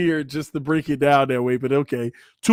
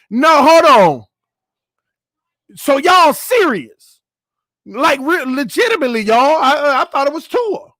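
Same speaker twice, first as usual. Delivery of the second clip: incredulous; 9 semitones higher, 4 dB louder than usual. Real, not acted. Disbelief at the name.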